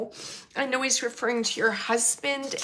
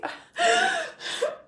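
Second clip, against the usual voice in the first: high, choked-up sob; real sob. Real, not acted. Medium overcome while relaying his message to Kathy, sobbing. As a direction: break into a real cry.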